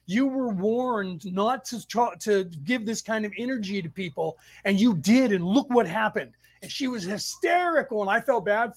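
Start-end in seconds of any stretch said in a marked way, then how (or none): none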